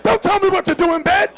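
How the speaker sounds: high, loud mock indignation; indignant outburst. Reacting to being told what to do in bed, indignant. Host warns against bossy partner, acted indignation.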